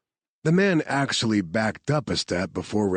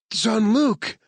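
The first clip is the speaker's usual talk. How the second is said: high, loud call for Jean-Luc; 4 dB louder than usual. Acted. Woman calling for her missing partner, high and loud. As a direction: cry his name into the ruins. Claire calls out for him.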